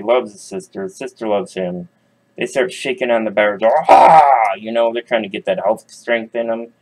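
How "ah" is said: straining grunt. Acted. Kids shaking cage bars.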